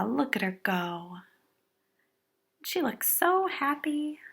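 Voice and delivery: ordinary delivery, nothing marked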